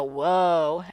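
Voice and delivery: low, wary whoa of discomfort; uneasy whoa. Shown as a demonstration. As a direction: back away with a low whoa. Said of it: "and what makes you go".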